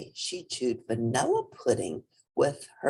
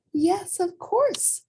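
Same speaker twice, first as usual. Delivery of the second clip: gentle reassurance; much slower than usual. Acted. Mother rabbit answers slowly.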